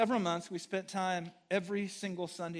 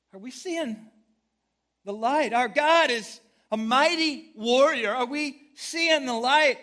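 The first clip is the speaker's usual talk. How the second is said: loud, rising preacher's call, slower at the end; preaching at full force; 6 semitones higher, 15 dB louder, slower than usual. Acted. Preacher calling out to the church, full force. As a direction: drive the question home to the church.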